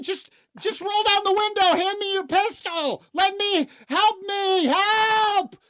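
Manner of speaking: high-pitched shouted cry for help; acted frantic victim, voice pushed way up; desperate plea. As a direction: beg loudly, building to a long yell. Acts out victim begging sleeping officer.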